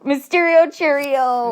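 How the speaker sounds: slow, playful sing-song. Pretend. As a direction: stretch it out like a silly chant. Playful name joke.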